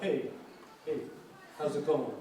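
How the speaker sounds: casual New York accent; New York street greeting accent. Shown as a demonstration. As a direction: imitate a New Yorker saying hey. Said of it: "got any of that when you were in New York".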